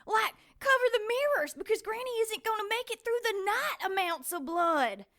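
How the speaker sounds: high, loud panic at the blood; alarmed outburst. Acted. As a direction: exclaim in rising alarm, pitched high. Alarmed at his bleeding wound.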